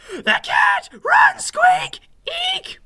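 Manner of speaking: very high, loud squealed warning; panicked shriek. A narrator's reading. Sister mouse sees the real cat, shrieking in panic. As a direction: scream it out as high as you can.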